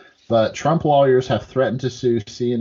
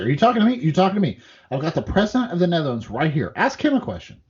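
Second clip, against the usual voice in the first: mock Trump; 4 semitones higher, faster than usual. Trump brushing off a reporter.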